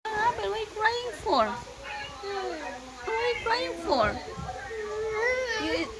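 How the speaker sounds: cooed question in a high voice. Real, not acted. Talking to a crying child.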